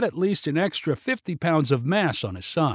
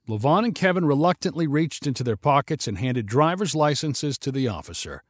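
ordinary delivery

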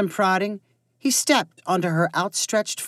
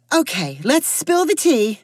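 gossipy, eager and sly; eagerly devious; 7 semitones higher, 3 dB louder than usual. A narrator's reading. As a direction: relish the gossip, a bit scheming. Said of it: "in an eagerly devious voice".

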